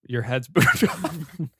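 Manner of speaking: breaking up into laughter; laughing. Real, not acted. Joker telling his friend his head's booped off, laughing. Cracks up at his own joke.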